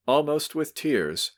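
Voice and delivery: ordinary delivery, nothing marked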